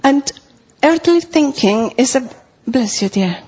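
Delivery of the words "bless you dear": ordinary talk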